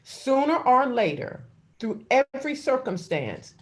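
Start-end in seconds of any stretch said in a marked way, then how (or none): 0.0-1.4 loud, high preaching build; full-force sermon cry. Acted. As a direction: drive it home from the pulpit. Preaching climax.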